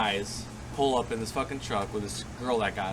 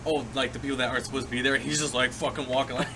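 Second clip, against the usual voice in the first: normal talking voice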